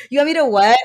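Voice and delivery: high, loud disbelieving question; acted-out indignant double take; mock incredulity. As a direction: balk at an outrageous request. Acts reaction to bilingual job demand.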